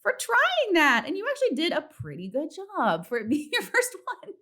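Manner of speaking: very high, stretched-out sweet praise; gushing self-encouragement. Retold or imitated. To herself in the mirror after bombing, high and cooing. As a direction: coo at yourself after bombing. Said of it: "looked in the mirror and been like".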